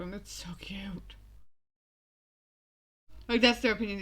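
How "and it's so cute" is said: whispered gush. Real, not acted. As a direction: breathe it out softly. Gushing over couple.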